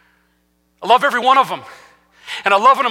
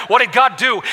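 usual speaking voice

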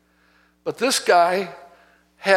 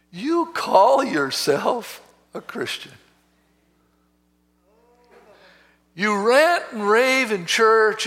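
accusing enemy; 3 semitones higher than usual. Retold or imitated. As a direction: stretch each charge out to shame the listener. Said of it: "the enemy came".

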